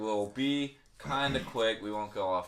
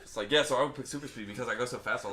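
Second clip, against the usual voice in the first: fast rush of words; rapid-fire; faster than usual. Shown as a demonstration. Proving to friends he talks too fast, rapid-fire. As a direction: race through it without a breath. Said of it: "Even I talk like that".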